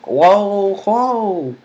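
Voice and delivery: high, loud startled whoa; surprised whoa. Real, not acted. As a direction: caught off guard, high and loud. Surprised at the payback.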